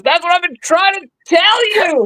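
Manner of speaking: high, yelled punchline; acted outburst at the end of one's patience; exasperated shout. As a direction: blow up at a customer who won't listen. Exasperated clerk's punchline in joke.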